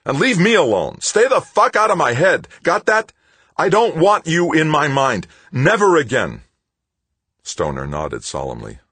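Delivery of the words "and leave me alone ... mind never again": high, loud furious shouting; screaming rage. Acted. Tavalera screaming at Stoner to stay out. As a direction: scream him out of your head.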